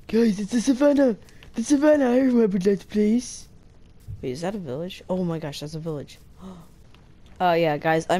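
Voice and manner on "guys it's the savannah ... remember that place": high, loud, overjoyed cry; reciting a favourite line, much higher and louder; excited recited line. Retold or imitated. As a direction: shout it out like a thrilled kid. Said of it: "I'm not gonna recite Cringe Minecraft things".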